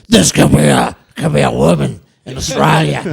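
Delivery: loud put-on broad Australian woman's voice; mock Australian woman. Mocking how Australian women sound, loud broad Aussie. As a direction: lampoon an Aussie woman's twang.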